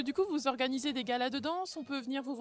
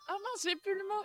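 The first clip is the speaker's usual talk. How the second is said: laughing; 5 semitones higher than usual. Real, not acted. Laughs while dancing on air.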